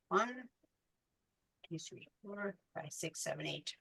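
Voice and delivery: soft murmured counting to herself; half-voiced tally while working; counting under breath. Real, not acted. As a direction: count the beads quietly, almost to yourself. Counting beads under her breath.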